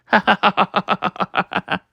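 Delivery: rapid, evenly spaced ha-ha laugh; acted laughing fit. Luffy hit by laughter paint, acted laughing fit. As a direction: rattle out a put-on belly laugh.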